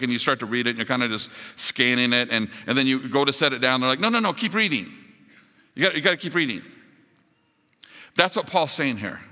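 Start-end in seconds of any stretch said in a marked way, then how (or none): 4.0-6.6 higher, pleading no no no; insistent friend. Retold or imitated. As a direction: urge them not to stop reading. Insistent friend stops reader.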